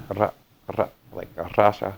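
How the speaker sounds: "Rasha" with a Russian-accented R. Shown as a demonstration. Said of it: "when speaking with a Russian accent".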